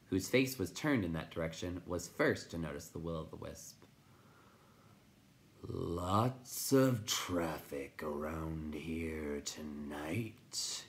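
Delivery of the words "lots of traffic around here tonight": very slow, drawn-out creature voice; crackling. A narrator's reading. Rock Chewer remarks on passing traffic, slow crackle. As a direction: crackle it out with long, dragged words. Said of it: "he crackled".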